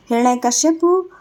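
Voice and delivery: usual speaking voice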